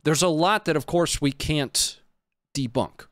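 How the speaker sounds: normal talking voice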